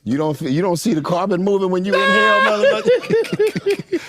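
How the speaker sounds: stretched, high, laughing question; laughing tease. Real, not acted. Mocking Shannon's breathing, laughing. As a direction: squeal the last word through laughter.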